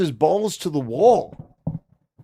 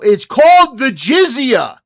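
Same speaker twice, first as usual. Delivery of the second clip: it's called the jizya, said louder and higher; loud correction; 5 semitones higher, 8 dB louder than usual. Real, not acted. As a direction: call it out firmly across the room. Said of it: "Hey, Sneako".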